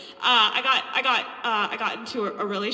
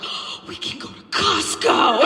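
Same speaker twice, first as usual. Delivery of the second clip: excited gasp; 3 semitones higher than usual. Retold or imitated.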